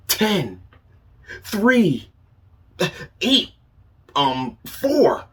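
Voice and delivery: excited countdown. Acted. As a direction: count down wildly, getting the numbers wrong. Chicken counts backwards wrongly.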